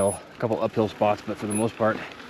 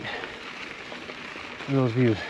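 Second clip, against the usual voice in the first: slow, airy, awed whisper; barely voiced, taking in the view; breathless whisper; 9 dB quieter, much slower than usual. Real, not acted. Summit reached after long climb.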